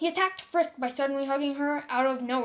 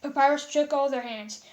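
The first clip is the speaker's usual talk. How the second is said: plain reading voice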